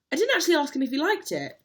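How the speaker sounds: high, loud, fast exclaim; sudden realisation. Real, not acted. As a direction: blurt it out in surprise.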